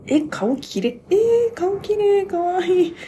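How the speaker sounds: high, loud, slow gasping awe; excited squeal. Retold or imitated. Fan reliving first sight of the visual, squealing high. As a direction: gush over a pretty face.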